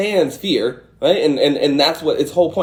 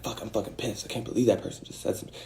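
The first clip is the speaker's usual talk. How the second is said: muttered under the breath, much softer, fast at the end; muttered anger; 4 semitones lower, 14 dB quieter, faster than usual. Retold or imitated. Voicing someone fuming privately, soft muttered anger. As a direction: mutter it angrily to yourself. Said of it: "You're like".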